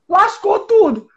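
high, emphatic outcry; dramatic exclamation. Real, not acted. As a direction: exclaim it like a disaster. Exclaims the bad outcome. (exclamação dramática)

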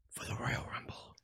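whispered, teasing. Acted. Teasing the next show to listeners in a whisper. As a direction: breathe it out softly for suspense. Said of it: "whisper heard".

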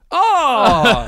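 loud, high "oh" of dawning understanding; hammed-up outburst of realization; mock realization. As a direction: act out the penny suddenly dropping. Acting out a delayed realization.

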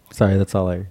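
normal talking voice

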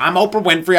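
hammed-up talk-show host; mock Oprah. Comic doing Oprah, insisting on her own name. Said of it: "keep calling yourself".

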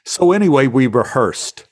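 usual speaking voice

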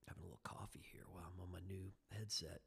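quick whispered aside, half to himself; hushed quick side comment. Real, not acted. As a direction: toss it off in a fast murmur. Quick aside about coffee, headset.

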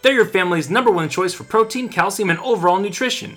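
radio ad read. Acted. Closing sponsor read.

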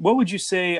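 ordinary talk

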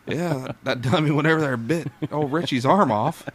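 hammed-up old gossip lady; gossipy old woman voice. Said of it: "Doris is like".

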